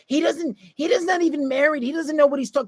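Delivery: mock girlfriend dismissing the video; mock dismissive girlfriend. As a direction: mimic a girlfriend brushing him off. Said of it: "You're like".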